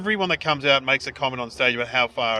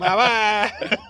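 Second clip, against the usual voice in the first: high-pitched mock Kazakh accent; Borat; 8 semitones higher, 3 dB louder than usual. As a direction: toss out the catchphrase in a fake Kazakh accent. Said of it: "imagine following Borat".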